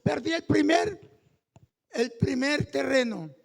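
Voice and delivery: loud sung-out preaching over music; preaching at full force. Acted. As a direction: call it out to the congregation, swelling. (predicación a todo pulmón, casi cantada)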